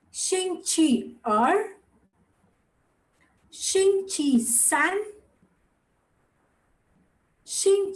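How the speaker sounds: slow, deliberate Mandarin for learners; repeat-after-me drill. Shown as a demonstration. Teacher leading a class in repeating the weekdays, slow drill. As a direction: model each weekday slowly, leaving room to repeat. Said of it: "Y'all can repeat after me".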